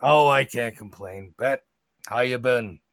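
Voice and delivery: a big bugbear's easy drawling greeting. Acted. Bugbear Joe greets Bet at the bar, in a big creature voice. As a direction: play the giant bugbear, relaxed and friendly.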